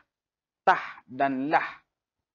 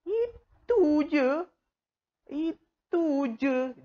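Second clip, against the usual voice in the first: put-on much higher, slow, whiny child voice; child complaining; 13 semitones higher, much slower than usual. Child grumbling at a parent over two ringgit. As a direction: drag out itu je like a disappointed kid.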